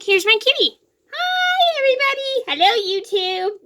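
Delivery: high sing-song pet talk; cat greeting. Real, not acted. Girl greets her cat Roscoe in a high cooing voice. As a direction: coo hello up high to the cat.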